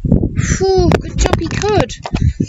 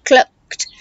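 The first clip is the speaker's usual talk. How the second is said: ordinary delivery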